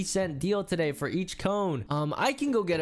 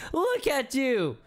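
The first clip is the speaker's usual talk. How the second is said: laughing at him, higher than usual; taunting laughter, slower on the last words; mocking laugh; 7 semitones higher, slower than usual. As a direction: jeer through a cackle. Bully mocks him, laughing.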